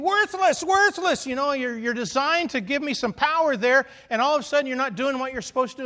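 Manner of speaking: high, loud exasperated scolding; mock angry yelling at a drill. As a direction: yell at the dead tool in frustration. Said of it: "my natural response is to yell and scream".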